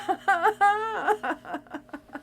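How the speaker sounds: high, half-laughing sob; real blubbing laugh; tearful outburst. Real, not acted. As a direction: break into high laughing tears. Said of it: "it's quite upsetting".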